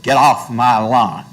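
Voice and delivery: mild mock yell of a grumpy old man; lightly voiced angry old man, a touch forceful. Angry old man to neighborhood children from his porch, mildly mock-scolding. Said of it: "angry old man who stands on his front porch and screams".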